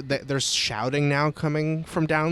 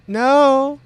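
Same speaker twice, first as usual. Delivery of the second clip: high, loud, overdone no; unconvincing denial; 12 semitones higher, 10 dB louder than usual. Acted. Cultist denies being devout to questioner, sounding like a lie. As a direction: deny it too forcefully, pitch leaping up. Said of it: "The middle one sounded like a lie".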